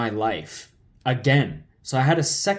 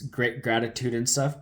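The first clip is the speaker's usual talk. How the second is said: ordinary delivery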